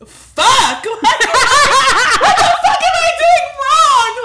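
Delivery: high, loud, tearful laugh-cry outburst; crying through laughter. Real, not acted. Close to tears, telling her co-host about dating, laughing through a loud cry. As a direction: she breaks down laughing and crying at once. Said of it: "the tears are about to drop".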